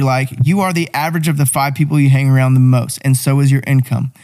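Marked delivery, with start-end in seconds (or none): none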